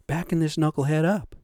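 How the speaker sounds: normal talking voice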